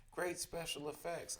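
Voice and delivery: lightly voiced boy calling from the crowd; shouting. A narrator's reading. Boy in first row to the band, mild shout. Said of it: "I heard a boy from the first row shout".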